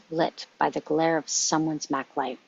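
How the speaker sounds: ordinary talk, nothing marked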